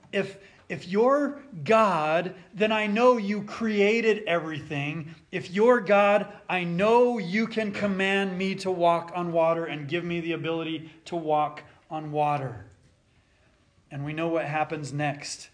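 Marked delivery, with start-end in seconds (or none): 0.1-10.9 fervent preaching. Acted. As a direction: preach it out with fire. Preaching at full force.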